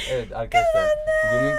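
laughing. Real, not acted. Announcing the winner, laughing.

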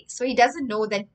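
ordinary delivery

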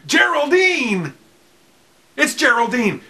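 high, loud excited reveal; excited announcement. Real, not acted. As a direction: announce her like a showman. Announcing the finished guitar.

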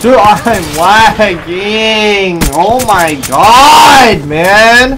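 slow, loud, drawn-out wail; anguished yell. Real, not acted. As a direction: wail it at the screen. Raging at lag.

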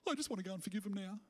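gasp, then fast breathy rush of fake delight; mock gushing. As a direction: gush like a sudden warm fuzzy feeling. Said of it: "it's like".

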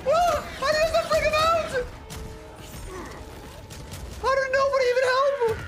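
high, frantic calls to teammates; panicked shouting. Real, not acted. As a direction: scream for help, frantic. Teammate stuck, panicked calling out.

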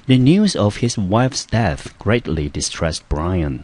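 careful, deliberate classroom reading; slow English example read to the class. Shown as a demonstration. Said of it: "来，我们看例句".

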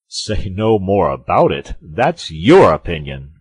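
loud, incredulous repetition; indignant echo. A narrator's reading. Porthos throws Aramis's words back at him, indignant.

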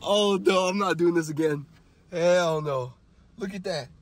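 ordinary talk, nothing marked

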